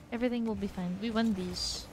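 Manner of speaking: ordinary delivery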